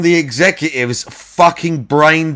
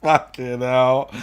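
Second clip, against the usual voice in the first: ordinary talk, nothing marked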